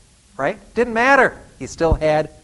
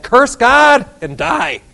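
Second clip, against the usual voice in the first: high, loud, slow mocking wife; scornful wife; 9 semitones higher, 9 dB louder, much slower than usual. Job's wife sneering at him, high and slow. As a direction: drag out the words as the scoffing wife.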